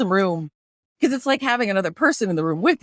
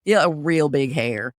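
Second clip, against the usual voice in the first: put-on Southern accent; 7 semitones lower than usual. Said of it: "So I'll do Southern".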